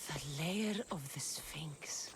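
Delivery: slow hushed whisper of awe; whispered, slow, in awe at the sight; awed whisper. Acted. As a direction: breathe it out in wonder. Awed arrival at a lair.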